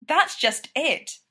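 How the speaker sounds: exasperated outburst; bursting out. A narrator's reading. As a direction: burst out with it, unable to hold back. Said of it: "Sidney burst out".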